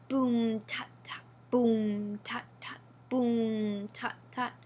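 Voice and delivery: low boom then whispered tut tut; drum rhythm. A narrator's reading. Storyteller voices the council drum, slow drum rhythm. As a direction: beat it out slowly like a drum.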